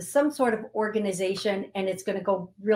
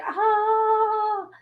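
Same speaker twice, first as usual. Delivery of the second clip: a much higher, louder, long overwhelmed "ah"; overwhelmed sigh; 14 semitones higher, 5 dB louder than usual. Retold or imitated. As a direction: voice everyone's release in one high cry. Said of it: "The feeling I'm getting from everybody as I say this is like".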